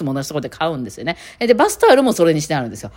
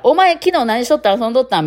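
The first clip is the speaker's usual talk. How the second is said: lightly voiced Kansai youngster, pitched higher; young pals' casual talk; 6 semitones higher, 3 dB louder than usual. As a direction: tease out casual Kansai slang like a mate. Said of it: "若者同士のタメ語なんですよ". (若者同士の関西弁タメ語を軽く真似て)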